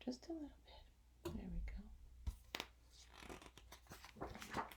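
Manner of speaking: soft whisper off to the side; whispered aside. Real, not acted. Aside to helper about light.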